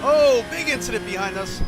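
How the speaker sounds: loud, surprised call about a crash; startled exclamation. Real, not acted. Sim race crash behind them.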